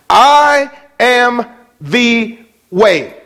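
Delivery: slow, weighty proclamation; solemn preaching. Acted. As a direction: drop each word like a gavel. Building the gavel-drop quote.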